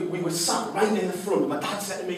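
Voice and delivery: ordinary talk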